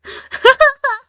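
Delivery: giggling squeal; high laugh. Real, not acted. Laughing at herself.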